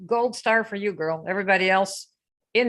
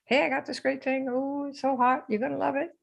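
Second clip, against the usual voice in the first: higher, lightly mocking eager seller; pitched-up mock sales pitch; mock sales gush; 5 semitones higher, 4 dB quieter than usual. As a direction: gush it like a pushy seller posting online. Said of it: "Anybody posted that or told people that".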